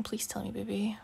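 tender whisper; whispered coaxing. Acted. Coaxing her upset friend to open up, whispering.

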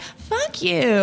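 high, lilting "fuck you"; different mood. Shown as a demonstration. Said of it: "There's a thousand ways to say it".

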